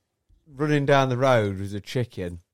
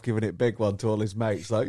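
usual speaking voice

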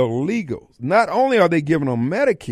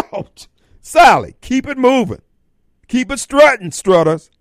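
laughing, louder, higher brush-off; laughing dismissal; 7 semitones higher, 6 dB louder than usual. Retold or imitated. Host brushing off Sally Struthers, laughing and loud. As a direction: wave her off with a loud laughing jeer.

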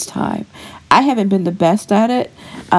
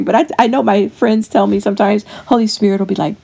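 ordinary talk, nothing marked

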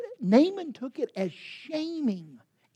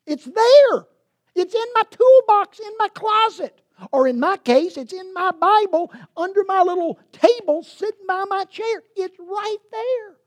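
higher, louder, ringing insistence; emphatic preaching; 8 semitones higher, 7 dB louder than usual. Real, not acted. Preacher insists to the congregation, high and loud. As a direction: lift it up and drive it home.

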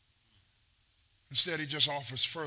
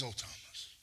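soft, lower whispered words of Jesus; gentle whisper; 9 semitones lower, 12 dB quieter than usual. Retold or imitated. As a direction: breathe it tenderly to Thomas. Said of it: "and he says".

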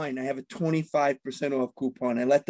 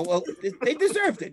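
ordinary talk

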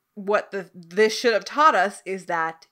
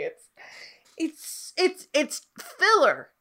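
ordinary delivery

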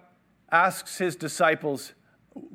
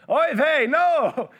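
much higher, louder, half-laughing dismay; shocked Oy vey; 11 semitones higher, 7 dB louder, much slower than usual. Retold or imitated. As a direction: recoil in horror at the news. Peter's shocked reaction.

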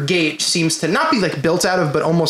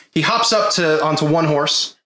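ordinary delivery

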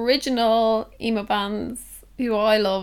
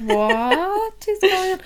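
confused; 8 semitones higher, 4 dB louder, much slower than usual. Retold or imitated. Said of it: "I was like".

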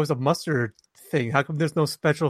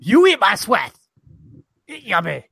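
put-on louder, higher jokey North Korean accent; mock North Korean accent; 5 semitones higher, 4 dB louder than usual. Host voicing Kim Jong-un for his co-host, mock North Korean accent. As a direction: ham up a North Korean dictator boast. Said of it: "You have the best accents".

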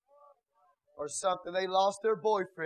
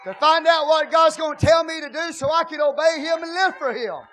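shouted, higher, much louder sermon; full-force preaching; 8 semitones higher, 14 dB louder than usual. Acted. Pastor exhorting the congregation, shouted full-force preaching. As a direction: preach it hard to the church.

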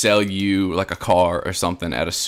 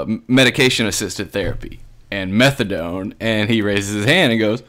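ordinary delivery

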